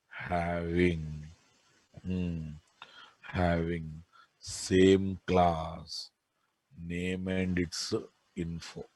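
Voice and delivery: slow, soft dictation for note-taking. Acted. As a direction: read it out word by word, slowly. Dictating notes slowly.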